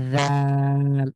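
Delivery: জাল with tongue on teeth, held very long; drawn-out dhaal. Shown as a demonstration. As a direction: hold the thin জাল out slowly. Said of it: "পাতলা করে বাতাস বের করে".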